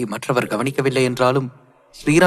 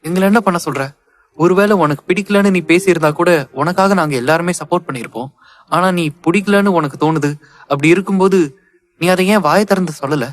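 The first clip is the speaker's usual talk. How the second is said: loud, high-pitched remonstrance; exasperated scolding; 5 semitones higher, 5 dB louder than usual. Acted. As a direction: scold her, voice raised in frustration. Cousin scolds the crying bride. (ஆதங்கத்துடன் உரத்த குரலில்)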